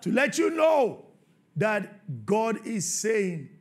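high, loud sermon delivery; preaching at full force. Acted. Preacher stirring the church about Jesus' command, shouting. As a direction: hammer the point to the congregation.

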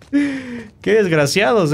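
slow, high, laughing scorn; laughing insult. Real, not acted. Gamer mocking hidden enemies, slow laughing scorn.

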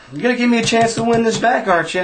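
high-pitched mock plea for another chance; retold pleading. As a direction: plead high, acting out the sore loser. Loser pleads again to win back.

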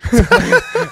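loud high cackle; burst of laughter. Real, not acted. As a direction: break up laughing. Laughing at the chicken heckler.

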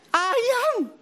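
high-pitched wife's voice, one stubborn word. Acted. Wife to husband, digging in: high stubborn "Ayam". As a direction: squeak it out, refusing to budge.

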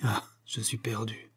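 unvoiced, soft whisper of defeat; hoarse despair. A narrator's reading. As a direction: breathe it out, beaten. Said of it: "d'une voix rauque". (murmure rauque et soufflé du coupable perdu)

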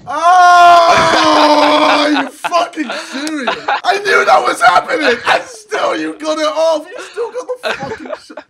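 loud, laughing disbelief; laughing outrage. Real, not acted. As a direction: shout it while laughing. Disbelief at opponent's goal.

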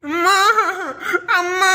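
put-on high little-boy call; child calling mum. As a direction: cry out after her like a toddler. Child calls mother again.

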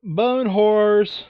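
ordinary delivery

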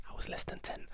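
hushed, voiceless whisper; whispered aside. Real, not acted. Vlogger admitting their age to viewers, whispered. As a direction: whisper it under the breath. Quiet aside after giving birth year.

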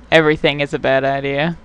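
ordinary talk, nothing marked

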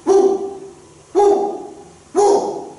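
dog barking. A narrator's reading. Preacher barks like the dog in the story, high yelps. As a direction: bark like a dog, yelping high. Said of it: "And the dog started barking".

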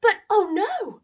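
storyteller's alarmed oh no. A narrator's reading. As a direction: gasp it out in dismay. Mouse appears.

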